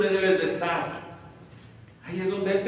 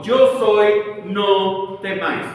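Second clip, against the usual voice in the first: booming, louder, slow on no temáis; thunderous voice; 3 semitones higher, 8 dB louder, much slower than usual. Shown as a demonstration. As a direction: proclaim it like thunder over the storm. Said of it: "habló con una voz potente, como habla Dios, con una voz de trueno". (voz de trueno potente)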